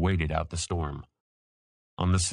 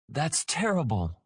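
gasped; 8 semitones higher than usual. A narrator's reading. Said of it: "Cam gasped".

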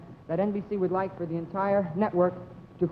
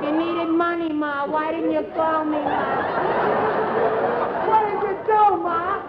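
pleading son; 7 semitones higher, slower than usual. Acted. Dramatic movie scene pleading with Ma.

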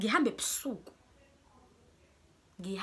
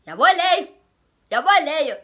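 very loud, high outburst of disbelief; outraged exclamation; 8 semitones higher, 17 dB louder than usual. Real, not acted. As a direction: shout it out in outrage. Outraged at his excuse.

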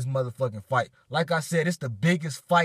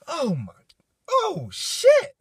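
overwhelmed disbelief; 14 semitones higher, 4 dB louder, slower than usual. Real, not acted. Reacting to official fight news.

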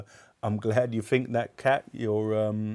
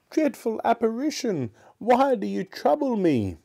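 high-pitched frightened pleading; terrified Scrooge; 14 semitones higher, 4 dB louder than usual. Acted. Scrooge pleading with the apparition, high and terrified. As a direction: wail it at a dreadful phantom. Scrooge begs the ghost on knees.

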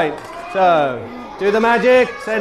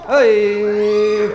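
usual speaking voice

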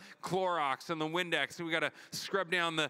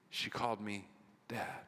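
soft, hushed, lower; tender tearful whisper; 5 semitones lower, 7 dB quieter than usual. Retold or imitated. God moved to tears by his child, hushed and tender. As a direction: let it catch, awed and moved.

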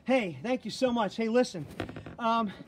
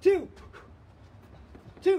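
shouting counts; 8 semitones higher, 8 dB louder than usual. Real, not acted. Coach shouting counts in class.

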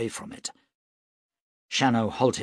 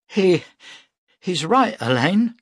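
plain reading voice

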